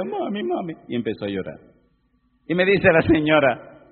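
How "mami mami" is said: put-on high small child's voice calling mami; a child crying for his mother. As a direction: call out like a little boy to his mother. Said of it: "el chiquito le decía".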